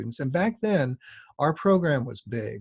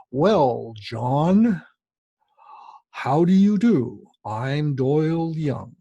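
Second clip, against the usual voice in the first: slow, louder, proper gentleman's drawl; Doyle Young impression; 6 dB louder, much slower than usual. Teacher imitating his famous guest's slow, proper greeting. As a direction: imitate a stately old master, each word unhurried. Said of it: "Doyle Young talks around three miles an hour".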